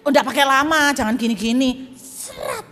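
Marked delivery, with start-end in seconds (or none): 2.0-2.6 unvoiced swish of a blade; slicing noise. Preacher showing a quick cut, slicing swish. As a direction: mimic a quick slice with the mouth.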